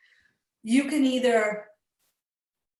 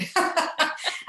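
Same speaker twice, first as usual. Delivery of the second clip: high burst of laughter; real laugh; 7 semitones higher than usual. Real, not acted. Speaker amused by joking with doctors, laughing. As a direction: laugh at your own joke. Laughing at own retold joke.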